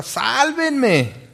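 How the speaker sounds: high, drawn-out cry for help; hammed-up desperate call for rescue; acted scream. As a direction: yell it like someone adrift among sharks. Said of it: "pues pegas un grito".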